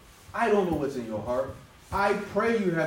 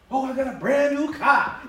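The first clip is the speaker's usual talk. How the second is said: higher, louder showing-off voice; mock bragging; 10 semitones higher, 6 dB louder than usual. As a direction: put on a proud new-car owner. Mocks a boastful churchgoer.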